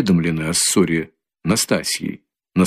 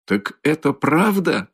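higher, slower shocked question; stunned cry; 7 semitones higher, 3 dB quieter, slower than usual. Acted. Mother learning the suitor was thrown out, stunned. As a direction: gasp it out in disbelief. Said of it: "вскричала Пульхерия Александровна".

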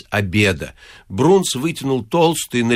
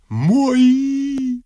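high, drawn-out pleading wail; abject insistence; 13 semitones higher than usual. A narrator's reading. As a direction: insist pitifully on one long high note. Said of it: "униженно твердил отец Фёдор". (униженно, тонко и протяжно)

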